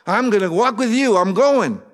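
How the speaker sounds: high, loud, rushed pledge; eager young follower. Acted. Young man vowing to follow Jesus, high and loud. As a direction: promise it all at once, eager. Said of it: "he said".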